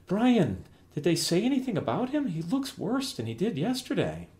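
high, anxious questioning; worried mother. Acted. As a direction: fret over the sick husband. Mom alarmed at dad's state.

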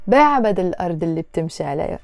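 whole phrase run together fast, الأرض linked to اللي; quick native flow, no gap after الأرض; with liaison. Shown as a demonstration. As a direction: join الأرض اللي and keep moving. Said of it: "Always put liaisons in Arabic".

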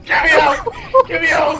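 loud, urgent get me out; yelling for help. Real, not acted. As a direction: shout to be freed.

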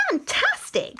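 very high, delighted exclamation; excited praise. Acted. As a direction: gush with admiration. Princess praises dragon.

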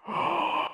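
high, soft intake of breath; gasp. Acted. Little fish recalling a shark, soft gasp. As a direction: gasp as if just frightened.

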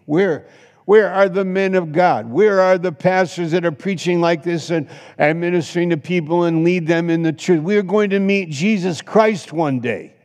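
high, loud pulpit challenge; fiery preaching. Acted. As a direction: call out the missing pastors. Preaching at full force.

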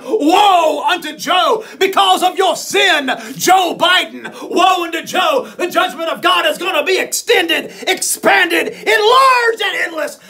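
slow, loud prophetic woe; shouted rebuke. Retold or imitated. Preacher rebuking Joe Biden to his face, shouting. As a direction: thunder the woe at him. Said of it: "I'll tell Joe Biden".

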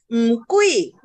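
KUI loud, falling steeply; forceful falling "kui"; sharp fourth tone. Shown as a demonstration. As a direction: drive "kui" down from the top. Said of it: "kui juga nada empat".

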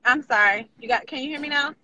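ordinary talk

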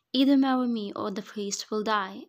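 loud, forceful threat; threatening ultimatum. A narrator's reading. Groom threatens the priest's life to force marriage, loud threat. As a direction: lay down the ultimatum loudly.

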